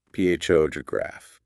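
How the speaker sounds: long "P H O", then quick light "to graph"; stress on first syllable. Shown as a demonstration. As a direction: stretch "P H O", hurry through "to graph". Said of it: "The other syllables are quick and quiet".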